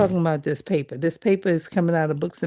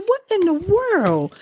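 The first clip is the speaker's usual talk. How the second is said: amazed; 11 semitones higher than usual. Retold or imitated. Reacting to cat growling.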